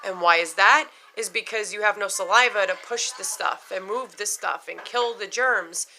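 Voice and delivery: ordinary talk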